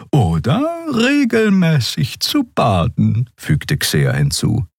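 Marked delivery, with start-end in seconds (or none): 0.1-3.3 high, slow, airy healer voice; Xea's voice. Acted. Healer teasing Endriel and Nelen about Keru, slow high voice. Xea adds a remark.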